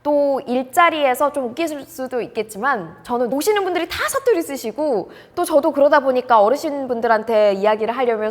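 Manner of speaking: ordinary talk, nothing marked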